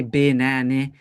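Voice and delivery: BANANA as bih-NAN-ih, short i at both ends; wrong vowels. Shown as a demonstration. Teacher showing the wrong banana to students, short i vowels. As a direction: swap the uh sounds of BANANA for short i. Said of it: "I can't say banana".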